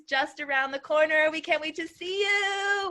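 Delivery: gushing anticipation; excited. Real, not acted. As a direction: build to an eager, high sing-out. Cheering the upcoming retreat.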